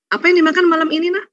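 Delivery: mock a mother-in-law checking on dinner. Mother-in-law asking daughter-in-law about dinner, lightly mocking. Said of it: "Ibu mertua komennya apa biasanya?".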